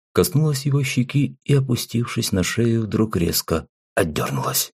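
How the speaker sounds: ordinary delivery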